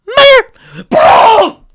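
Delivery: very high, loud battle yell; wild shout. Acted. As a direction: scream the blast out. Explosive spell shout.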